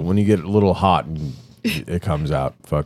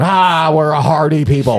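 proud, hearty yell, pitched up high; hardy Midwesterner; 7 semitones higher, 6 dB louder than usual. Retold or imitated. Mocking Wisconsin locals boasting through the winter, hammed-up hearty shout. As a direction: boom it out like a tough northerner. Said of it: "They're up there in the fucking winter going".

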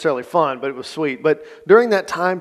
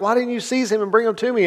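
a higher, mock indignant demand; 8 semitones higher than usual. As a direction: play the scolding chief priest, lightly. Leaders scolding the sub-rabbis.

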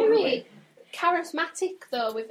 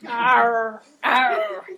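pirate arr; 7 dB louder than usual. Acted. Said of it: "That sounded more like a pirate".

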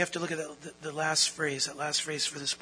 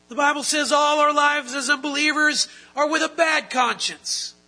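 rising, louder preacher's proclamation; full-force preaching; 8 semitones higher, 10 dB louder than usual. Acted. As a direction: preach it out, driving each phrase home.

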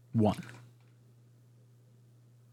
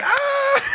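mock scream. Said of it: "I'm like".

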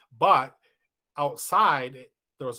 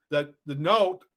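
ordinary talk

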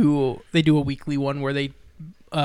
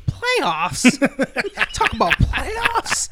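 mock-outraged coach, much higher and slow, cracking into laughter; hammed-up disbelief at the word playoffs, drawn out high; incredulous coach quote; 10 semitones higher, much slower than usual. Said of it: "That's such an amazing quote".

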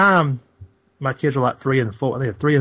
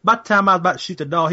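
ordinary talk, nothing marked

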